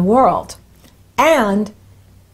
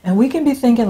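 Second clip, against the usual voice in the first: ordinary talk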